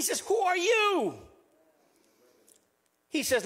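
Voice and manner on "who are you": incredulous. Retold or imitated. Friend's astonished question, retold.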